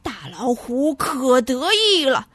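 ordinary delivery, nothing marked

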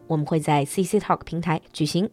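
brisk, fast announcer read over music; fast upbeat ad voice; promo ad read. Acted. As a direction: rattle off the event notice like a radio ad. Host reads promo announcement.

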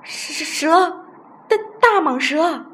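high, loud, terrified stutter; stammering yell. A narrator's reading. Old Chen thinks he felt a snake, panicked stammer. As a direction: cry out in fright, tripping on first syllables. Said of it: "嘴里结结巴巴嚷着".

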